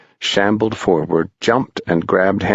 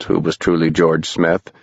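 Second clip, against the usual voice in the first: usual speaking voice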